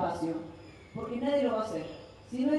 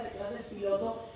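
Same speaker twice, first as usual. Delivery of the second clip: ordinary talk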